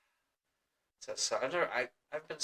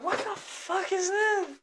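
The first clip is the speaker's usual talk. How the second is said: high-pitched incredulous complaint; outraged disbelief. Retold or imitated. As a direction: wail it way up high. Retelling outrage on Christmas morning.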